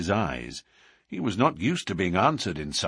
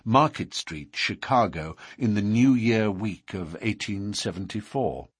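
ordinary delivery, nothing marked

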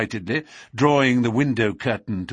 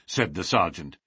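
ordinary delivery

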